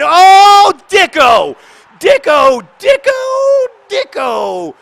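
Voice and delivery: high, full-volume repeated shouts; goal celebration. Real, not acted. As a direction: bellow the scorer's name in triumph.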